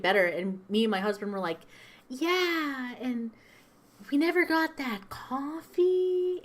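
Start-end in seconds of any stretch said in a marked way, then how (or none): 2.1-6.4 high, drawn-out hesitant reminder; awkward customer imitation. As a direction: act out sheepishly angling for the coffee. Acts out awkward customers' request.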